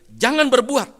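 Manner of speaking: very loud, high command; sudden forceful shout; shouted warning. Retold or imitated. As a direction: shout a stern prohibition at the crowd. Retold rebuke, shouted warning.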